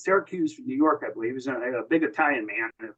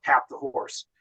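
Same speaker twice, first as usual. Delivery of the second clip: hushed call; slower than usual. Retold or imitated. The lieutenant in the bow of the landing boat calls the signal, hushed and breathless. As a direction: tap the horse almost whispered, barely voiced.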